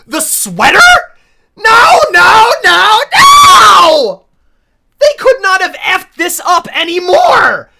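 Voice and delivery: high, loud wailing protest; despairing mock outrage at the ruined character; anguished shout. Real, not acted. Horrified reaction to Sophia's change.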